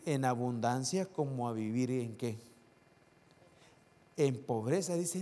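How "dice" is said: ordinary delivery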